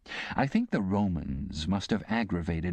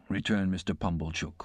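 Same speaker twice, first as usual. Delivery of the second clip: ordinary delivery, nothing marked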